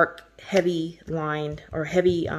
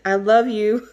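laughing through the words, louder; 5 semitones higher, 7 dB louder than usual. Real, not acted. Thanking the secret sender, laughing loudly and gushing. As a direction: giggle it out, overwhelmed with joy.

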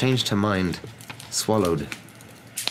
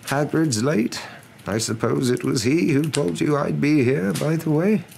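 higher-pitched, conversational wizard voice; wise wizard; 10 semitones higher, 3 dB louder than usual. A narrator's reading. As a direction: remark as an unhurried wizard, pitched up.

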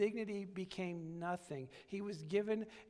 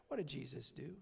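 normal talking voice

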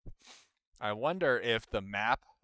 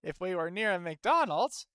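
high, loud hammed-up enthusiasm; mock excitement; 10 semitones higher, 7 dB louder than usual. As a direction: keep the put-on glee pitched high. Carries on the mock McDonald's excitement.

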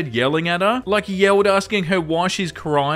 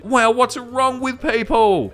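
higher, slow, incredulous wow; exasperated disbelief; 8 semitones higher, slower than usual. Real, not acted. Reacting to the rude mother and child, exasperated. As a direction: throw your hands up in disbelief.

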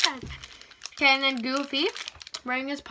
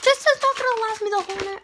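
high, fast complaint; acted outburst over too little candy; mock dismay; 8 semitones higher, 3 dB louder, faster than usual. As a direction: protest in a high-pitched rush.

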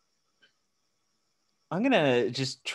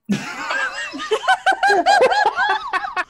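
big squealing laugh, much higher; high burst of laughter; 5 dB louder than usual. Real, not acted. Player laughing at failed plan, a high squealing laugh. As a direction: let a real laugh burst out.

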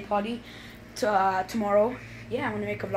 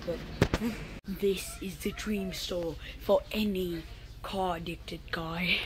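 normal talking voice

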